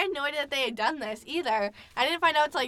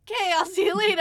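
higher, light and casual goodbye; breezy cheerful; 8 semitones higher, 5 dB louder, faster than usual. Retold or imitated. Leaving Ethan and his friends, breezy. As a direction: chirp it on the way out. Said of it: "And I'm like".

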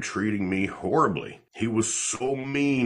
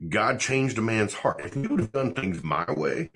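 usual speaking voice

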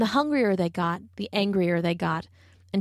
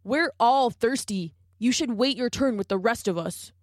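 warthog hollering; 3 semitones higher than usual. A narrator's reading. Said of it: "A stout warthog ... hollered back".

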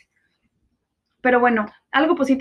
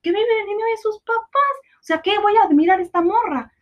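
higher, scornful voice of her ex; ex-boyfriend quoted; 7 semitones higher than usual. Retold or imitated. Quoting her ex-boyfriend dismissing a spoiled girl, higher and scornful. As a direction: scoff at the spoiled girl as the ex did. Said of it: "mi exnovio que decía".

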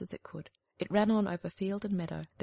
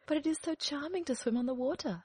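higher, dreamy duckling voice; wistful duckling; 7 semitones higher than usual. Acted. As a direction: yearn like a young duckling. Duckling longs.

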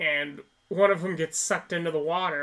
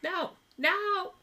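high-pitched, unbothered mock pleading; mock undistressed villain; 13 semitones higher than usual. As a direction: plead in a high voice without real distress. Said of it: "he doesn't sound distressed at all".

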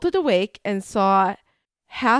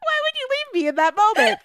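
laughing wail; much faster than usual. Real, not acted. Startled friend wailing at her abandoner through laughter.

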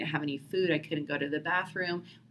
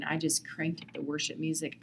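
usual speaking voice

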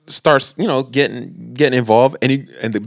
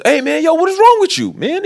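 high-pitched imitation of an indignant young moviegoer; mock confrontation. Young boy confronts the rude moviegoer, mock indignant. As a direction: act out a challenge, pitched well up.

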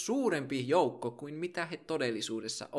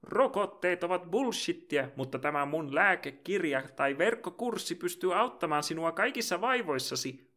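mock shouting charlatan. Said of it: "joka huutaa".

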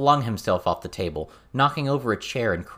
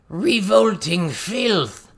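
higher and louder, drawn out with disgust; louder shout; 11 semitones higher, 6 dB louder, much slower than usual. A narrator's reading. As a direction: roar it at the rat, louder than the first time. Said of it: "Toad Swallow shouted, louder".

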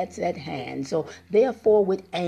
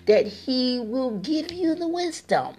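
fervent preaching; 3 semitones higher than usual. Acted. Preacher pleading with listeners to open their eyes, fervent. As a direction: lean on every promise.

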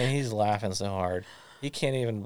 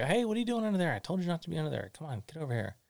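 retold yell; 9 semitones higher, much faster than usual. Parent calling son out from under stilt house, mock yell. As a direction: imitate calling a child over, pitch jumping up first. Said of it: "so I yell at him".

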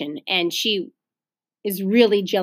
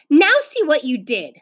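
higher-pitched schoolboy blame; raised-pitch accusation; accusing; 5 semitones higher, 5 dB louder than usual. A narrator's reading. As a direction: blame the other child. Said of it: "said Wayne".

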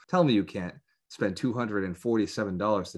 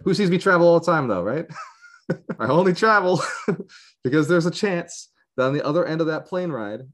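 laughing; 9 semitones higher, 6 dB louder than usual. Real, not acted. Joking about his travel.

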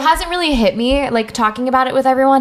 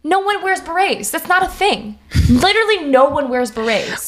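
emphatic denial; 6 semitones higher than usual. Real, not acted. Insisting berets are no thing.

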